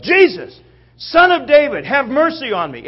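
high, loud pleading shout; yelling out. Acted. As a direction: call out over a crowd for mercy. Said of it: "he yells out".

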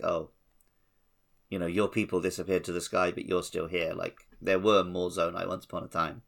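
ordinary talk, nothing marked

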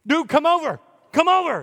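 high, loud shouts to the other boat; calling out. Retold or imitated. Fisherman shouting to another boat to come help, high and loud. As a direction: yell across the water for help.

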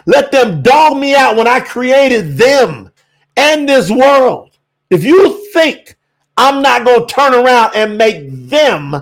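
preaching in God's voice, rising and pressing; fervent preaching. Acted. Preacher speaking as Yahuwah to doubters, fervent preaching. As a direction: thunder it from the pulpit as God.

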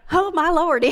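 loud, amused laugh; laughing at himself. Real, not acted. Pastor laughing at his accidental Southern twang, loud and amused. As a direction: crack up at your own slip.

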